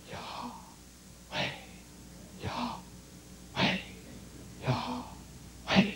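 voiceless breath in on Yah, out on weh; breathed name. Shown as a demonstration. Preacher shows congregation breath speaks the name, breathed whisper. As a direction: say it purely as slow breathing. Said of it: "Like this".

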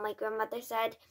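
plain reading voice

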